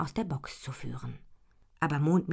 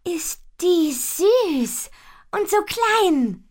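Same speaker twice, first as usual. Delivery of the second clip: very high, loud, drawn-out gush; squealing storybook delight; delighted cooing; 11 dB louder, much slower than usual. A narrator's reading. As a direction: gush slowly over something adorably tiny. Cooing over a tiny kitten.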